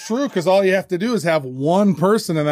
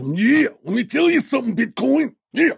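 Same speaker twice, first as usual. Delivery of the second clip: high put-on wrestler promo voice; Randy Macho Man Savage; 8 semitones higher than usual. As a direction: growl it like a hyped pro wrestler. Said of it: "And then a Randy Macho Man Savage".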